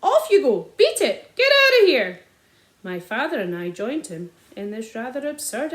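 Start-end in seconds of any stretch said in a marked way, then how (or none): 0.0-2.2 high, loud shoo calls; shouting at birds. A narrator's reading. Sergeant shouting to chase pheasants away, loud. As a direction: wave them off.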